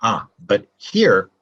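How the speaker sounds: usual speaking voice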